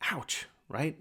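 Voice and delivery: breathy whisper, wincing; a hushed, stung aside; whispered ouch. Real, not acted. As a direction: whisper it like it stings. Wince after convicting quote.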